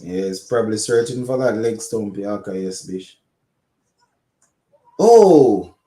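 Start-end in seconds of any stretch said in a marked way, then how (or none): 5.0-5.8 sudden oh. Real, not acted. Run-out chaos in commentary.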